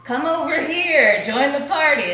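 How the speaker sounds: loud, high, excited call-over; drawn-out, eager shout; stoked invitation. Retold or imitated. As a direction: holler a welcome across the room. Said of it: "I'm, like, stoked".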